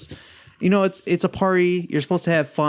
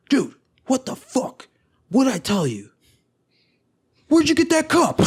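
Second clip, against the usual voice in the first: high-pitched, exasperated retold demand; retold angry confrontation; 10 semitones higher than usual. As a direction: act out an outraged "dude". Angry guy confronts drunk friend.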